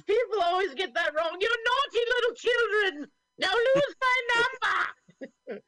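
put-on very high, loud scolding old lady; high falsetto old woman, amused. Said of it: "lady voice today".

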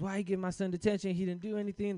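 high, indignant mom voice; angry mom. Retold or imitated. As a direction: defend her son against the teachers. Mom cussing out teachers.

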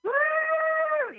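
high cheering crowd whoop; imitated crowd cheer; crowd woo. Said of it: "that's where the crowd would".

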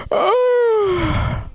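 high, drawn-out swooning oh; exaggerated high coo; mock amazement. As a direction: swoon in pretend awe. Imagined swooning reaction.

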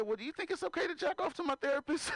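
high punchline. Retold or imitated. Punchline to therapist.

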